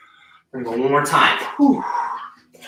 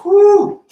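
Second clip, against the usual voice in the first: relieved whew; 8 dB louder than usual. Real, not acted. Exhale after set.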